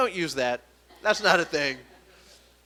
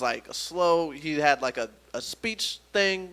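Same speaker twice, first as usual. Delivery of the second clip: ordinary delivery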